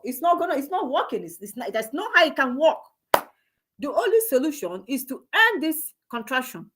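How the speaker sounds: ordinary talk, nothing marked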